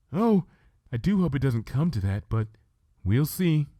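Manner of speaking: tremble the confession out gently; timid Fluttershy. Acted. Fluttershy voiced in story.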